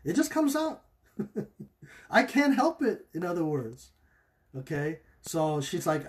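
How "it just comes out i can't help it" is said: high, whiny mock voice with a laugh; mocking whiny excuse. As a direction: mimic a woman making excuses, half laughing. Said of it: "she was justifying it".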